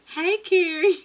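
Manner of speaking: bright loud high hello to a viewer; excited greeting. Real, not acted. Greeting a friend in the live chat, loud and high. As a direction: call out the name happily.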